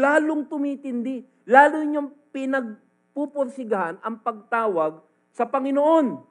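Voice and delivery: loud fervent sermon build; full-force preacher voice; preaching. Acted. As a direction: drive the point home to the congregation.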